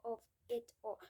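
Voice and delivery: usual speaking voice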